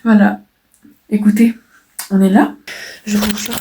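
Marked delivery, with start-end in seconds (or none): none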